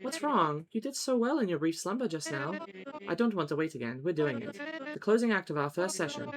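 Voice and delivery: stern hypnotist character, measured and articulated; Mesmer. Acted. Mesmer chiding an inattentive pupil over missed magic, impatient. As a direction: play an exacting magic tutor losing patience. Voices Mesmer reading game dialogue.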